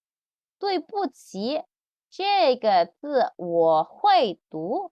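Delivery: "对不起，这个字我会读" slowly with clear tones; slow model. Shown as a demonstration. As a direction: model the apology. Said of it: "Dia nulis".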